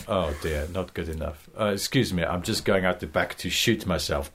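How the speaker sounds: low, quiet, exaggerated despair; gloomy boss laying it on. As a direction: ham up the misery. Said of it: "really laying it on thick".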